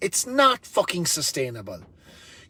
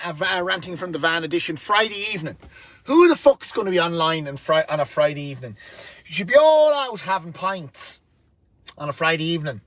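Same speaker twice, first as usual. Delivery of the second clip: normal talking voice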